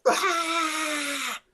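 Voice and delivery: high piercing pixie shriek; shrill pixie scream. Acted. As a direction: let out a tiny creature's scream. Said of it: "look at you and just scream".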